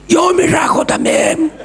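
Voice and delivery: shouted high as a bold prisoner; prisoner's answer. Acted. Preacher voicing a prisoner who would flee. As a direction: blurt it out like a convict ready to run.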